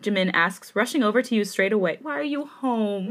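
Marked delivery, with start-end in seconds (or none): 2.0-3.1 crying. Real, not acted. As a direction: read the line through real tears. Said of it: "I'm crying".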